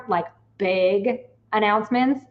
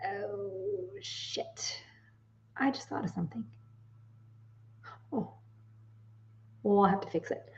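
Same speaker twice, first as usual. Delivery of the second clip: far softer, under the breath to herself; quiet oh-shit realization; muttered aside; 14 dB quieter than usual. Real, not acted. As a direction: drop away from the mic and mutter. Realizes a mistake mid-sentence.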